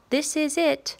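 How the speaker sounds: playful pretend yell at the moose; mock shout. Reader voicing the child telling the moose off, mock shout. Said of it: "Would you shout".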